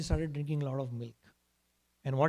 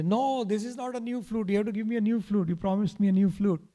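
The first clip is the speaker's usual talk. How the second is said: put-on higher-pitched little boy's protest; indignant protest; 5 semitones higher than usual. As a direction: object like a cheated child. Child protests at being tricked.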